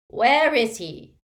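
slow, loud cold demand; menacing dark lord. Acted. Dark wizard cornering the woman, slow and menacing. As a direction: press the question slowly. Voldemort interrogates.

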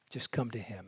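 sinking to a near whisper, tender; hushed. Real, not acted. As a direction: let it fade to a soft invitation. Tender invitation, voice dropping low.

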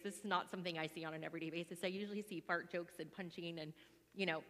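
ordinary talk

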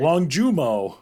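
American-accented "Longjumo", landing downward. Real, not acted. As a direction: attempt French "Longjumo" as an American, stated firmly. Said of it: "I sound like a dumb American".

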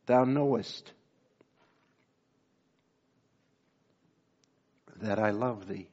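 fighting back tears on the words; breaking with feeling; choked up. Real, not acted. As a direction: voice catching with real emotion. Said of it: "I don't even know why I'm emotional".

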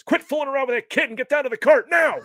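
high, loud yelled command; shouted order. Retold or imitated. Hero yelling at Short Round, shouted. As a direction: bark it across the fight. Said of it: "and he goes".

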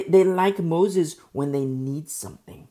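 usual speaking voice

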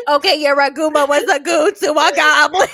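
hammed-up slurred rap nonsense through giggles. Friend mocking cohost's goblin, hammed-up rapper impression. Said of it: "I just hear [name]".

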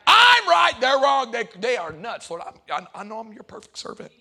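loud high outburst fading to a smug murmur; hammed-up smug prayer, starting loud and high; mock self-righteous prayer. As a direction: play the smug believer praying. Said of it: "That's how we talk".

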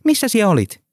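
high, eager question; excited question. Acted. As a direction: ask it bursting with excitement. Henttonen excited greeting continues. (innostunut, korkea kysymys)

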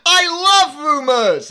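high, slow, gleeful quote with a laugh; impression of a Nazi officer. Retelling the film scene to friends, a delighted mock-villain voice. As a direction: savour every word of it. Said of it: "Except not in that accent".

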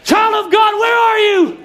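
very high, loud call, last words slow; father calling out. Acted. Preacher voicing God calling the congregation, loud and high. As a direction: call the lost one home, pleading. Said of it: "That same father calls out this morning".

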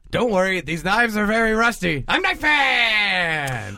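Knife Pants character. Pretend. Knife Pants reassures everyone about rusty knives, hammed-up heroic call. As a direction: announce yourself as a silly hero, drawing out the catchphrase.

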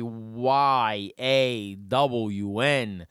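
very slow, stretched letters; spelled out slowly. Shown as a demonstration. Host spelling the debated word, slowly. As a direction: draw out each letter.

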